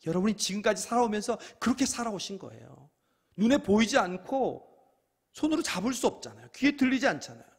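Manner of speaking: high, loud preacher's cry; full-force preaching. Acted. Pastor urging the congregation, loud and high. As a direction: preach it out to the back row.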